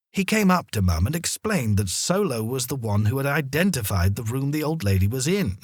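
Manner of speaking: plain reading voice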